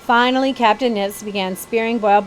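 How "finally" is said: ordinary delivery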